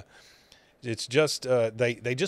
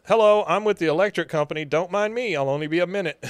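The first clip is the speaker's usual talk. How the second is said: disguised electrician; 6 semitones higher, 4 dB louder, faster than usual. Retold or imitated. Dale poses as electric company man.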